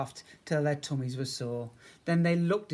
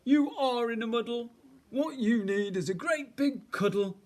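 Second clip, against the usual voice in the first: high, fussing bear voice; comforting bear; 9 semitones higher, 4 dB louder than usual. A narrator's reading. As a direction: coax like a doting big bear.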